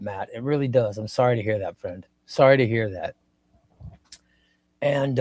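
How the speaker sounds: ordinary delivery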